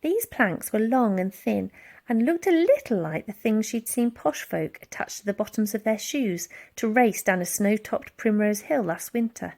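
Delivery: ordinary delivery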